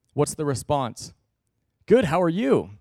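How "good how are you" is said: forced cheerful. Retold or imitated. Fake cheerful church greeting.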